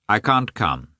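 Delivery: cool, understated refusal; cold. A narrator's reading. Karenin refusing his brother-in-law's invitation, cold. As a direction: say it coldly, with no welcome. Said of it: "said coldly".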